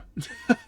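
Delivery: short high laugh; quick high-pitched chuckle; amused laugh. Real, not acted. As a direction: burst into a brief laugh. Laughs at his own remark.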